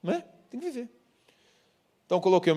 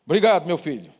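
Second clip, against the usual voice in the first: grateful father; 7 dB louder than usual. Retold or imitated. Father thanking son, retold.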